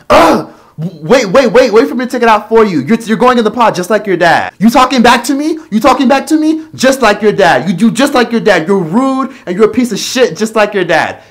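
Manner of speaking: high, loud scolding mother voice; nagging mom. Retold or imitated. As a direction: mimic a mother snapping at her kid, pitched high and loud. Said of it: "she'd be like".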